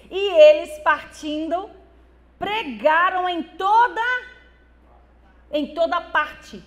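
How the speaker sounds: sudden loud burst on the words; preaching boom. Real, not acted. Pastor reading Mark aloud to church, loud burst.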